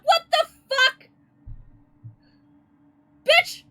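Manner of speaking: high, loud startled shriek; startled yell. Real, not acted. Player shrieking at a jump scare face. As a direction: yelp at the sudden face.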